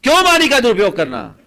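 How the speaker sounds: loud, high rhetorical challenge; impassioned exhortation. Acted. Preacher challenging listeners on misusing speech, loud and forceful. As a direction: throw the question out at full voice.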